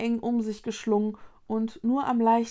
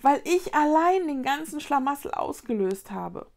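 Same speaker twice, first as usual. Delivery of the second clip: higher-pitched, dismayed admission; shocked realisation; 6 semitones higher, 3 dB louder than usual. A narrator's reading. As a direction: admit it in shock. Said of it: "begriff Vicky schockiert".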